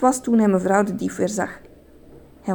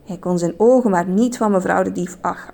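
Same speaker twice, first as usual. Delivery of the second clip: usual speaking voice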